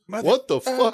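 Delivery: high, startled what-the-fuck; shocked outburst. Retold or imitated. The doctor stepping into piss, startled outburst. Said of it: "all you could hear after you heard the door click was".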